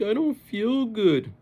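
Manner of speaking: very high, slow, dragged-out whine; whiny kid. Retold or imitated. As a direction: whine it like a worried child.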